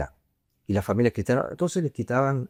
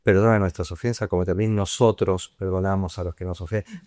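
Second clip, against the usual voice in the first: prayer; 3 semitones lower, faster than usual. Acted. Priest leading the congregation in prayer, recited prayer. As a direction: pray it steadily, eyes closed.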